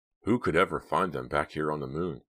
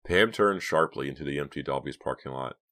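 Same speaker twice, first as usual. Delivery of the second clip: usual speaking voice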